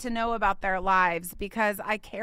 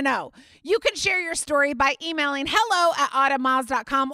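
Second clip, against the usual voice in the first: higher, louder, punchy ad read; upbeat promo read; 7 semitones higher, 5 dB louder than usual. Acted. Host inviting listeners to send praise reports, upbeat promo. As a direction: sell it like a radio spot.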